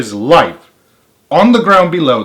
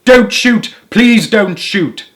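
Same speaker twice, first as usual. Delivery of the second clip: frightened plea; 9 semitones higher, 5 dB louder, much slower than usual. A narrator's reading. Begging armed ducks.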